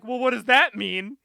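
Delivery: high, loud mock alarm; alarmed question. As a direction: demand an answer, hammed up. Acting out alarmed villains.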